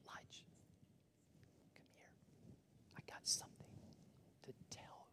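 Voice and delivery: breathy whisper of God calling close; hushed, intimate whisper. Acted. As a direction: lean in and whisper it. God voiced whispering to Elijah.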